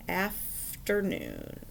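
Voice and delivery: Afternoon said slowly, syllable by syllable; slowly sounded out. Shown as a demonstration. Teacher reading the next word to pupils, slowly sounded out. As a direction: draw Afternoon out so each part is heard.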